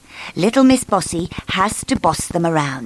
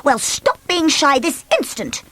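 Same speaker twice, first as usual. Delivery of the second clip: high, curt command; bossy order; 8 semitones higher than usual. Acted. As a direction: order her about. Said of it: "ordered Miss Bossy".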